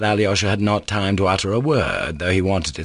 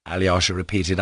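ordinary delivery

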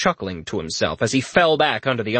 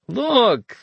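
higher, patronising sing-song; talking to a little kid; 12 semitones higher than usual. Acted. Richter talking down to his friend, high and patronising. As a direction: coax him like a small child. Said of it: "like he was talking to a little kid".

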